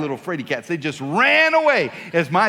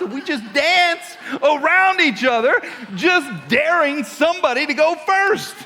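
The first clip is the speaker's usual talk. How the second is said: high, loud hammed-up build; worked-up storytelling; 9 semitones higher, 4 dB louder than usual. Retelling the dance of the tough guys, hammed-up. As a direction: raise it to a near-shout for the showdown. Acts out tough-guy standoff escalating.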